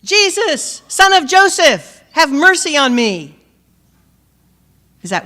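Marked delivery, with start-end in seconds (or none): 0.0-3.4 loud, high cry for mercy; shouting. Retold or imitated. Blind beggar crying out to Jesus, loud and high. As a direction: call out to Jesus at full voice. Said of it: "Notice what he shouts".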